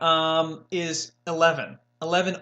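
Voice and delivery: usual speaking voice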